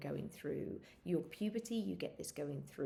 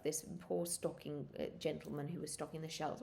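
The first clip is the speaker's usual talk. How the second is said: usual speaking voice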